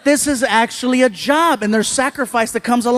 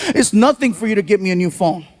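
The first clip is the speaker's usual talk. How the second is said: brisk retold teen complaint; quick, casual dismissal; 3 semitones lower, faster than usual. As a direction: toss the whole line off quickly. Retold son's quick remark, then repeated.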